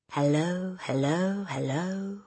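drawn-out parrot hello; parrot calling. A narrator's reading. Parrot calls down the chimney, sing-out bird voice. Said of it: "the bird called down to her".

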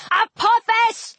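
high, drawn-out yelled name. A narrator's reading. Heroine challenging the serpent, high yell. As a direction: yell the name, pitched well up. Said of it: "I yelled".

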